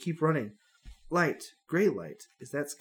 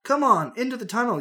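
loud urgent call; scream; 8 semitones higher, 6 dB louder than usual. Acted. Hero shouting at Stacy to get into the tunnel, loud call. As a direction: yell to drag her along. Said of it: "you scream at Stacy".

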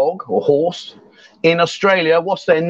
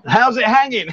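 higher, playful Aussie echo; imitated Australian accent; 4 semitones higher than usual. Echoing her Aussie phrase, mock Australian accent. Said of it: "Can you speak Australian".

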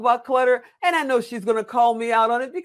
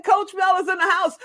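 hype announcement; 8 semitones higher than usual. Real, not acted.

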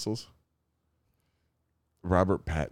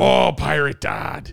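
slow, loud and higher pirate growl; pirate dad voice; 7 semitones higher, 7 dB louder, slower than usual. Pretend. Dad showing his friend his pirate dad voice, slow and loud. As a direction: drag it out like a stage pirate. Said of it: "It's my Pirate Dad voice".

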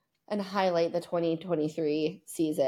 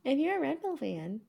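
mock echo; 7 semitones higher, 3 dB quieter than usual. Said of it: "the number of times I have to hear you say that".

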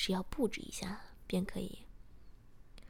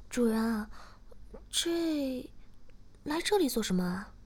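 lightly acted curiosity, a hesitant lift; curious; 4 semitones higher than usual. A narrator's reading. Shiya asking her master why they came, curious. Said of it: "诗雅好奇地说".

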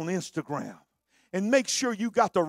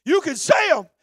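loud, rhythmic pulpit cadence swelling with fervor; roaring sermon, half chanted; preaching at full force; 7 semitones higher, 10 dB louder than usual. Acted. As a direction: preach it out, building the congregation up. Climactic preaching, shouted.

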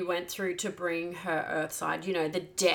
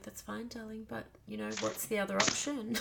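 much softer, gentle and calm; soothing midwife; 3 semitones higher, 9 dB quieter than usual. Retold or imitated. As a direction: coax her along like a kind midwife. Midwife soothing a labouring mother.

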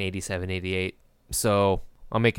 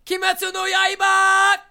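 high, loud anime battle shout; anime scream; 8 dB louder, slower than usual. Acted. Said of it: "anime scream".

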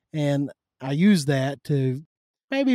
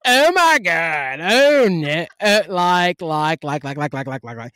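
exaggerated Valley girl, loud drawn-out opening running down into muttered filler; mock California Valley speak, stretched vowels; California Valley accent; 5 dB louder, slower than usual. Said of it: "California Valley accent".

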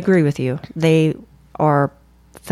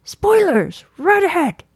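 high, drawn-out alarm call; mock lookout's warning cry; 11 semitones higher, slower than usual. Repeats the called-out warning.

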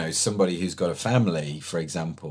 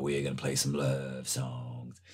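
slow, low, smooth late-night DJ; midnight radio DJ; 5 semitones lower, slower than usual. Acted. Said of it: "that midnight radio kind of D J voice".

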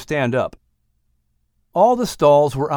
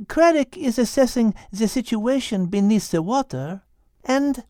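put-on high French-accented voice, calmly asking; high, poised French accent; 10 semitones higher than usual. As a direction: play Liz with a French accent, asking the whale. Liz French voice.

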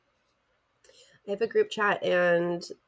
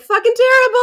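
loud high comic yell; mock outburst; 13 dB louder than usual. Co-host imagining answering a passerby, loud high mock outburst. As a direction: blurt it out far too loudly.

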